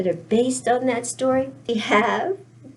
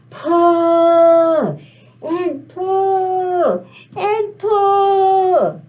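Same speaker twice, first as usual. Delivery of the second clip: long drawn-out, rising pulls; straining heave; 5 semitones higher, 6 dB louder, much slower than usual. Pretend. Storyteller leading everybody to pull the turnip, long effortful heave. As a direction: draw out each PULL as if hauling hard.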